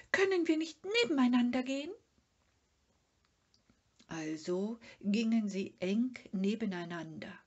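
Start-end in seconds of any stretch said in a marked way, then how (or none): 0.1-1.9 high, quick, nervous plea; pleading Sniff. A narrator's reading. Sniff begging Mumin to walk together, high and quick. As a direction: beg to walk side by side.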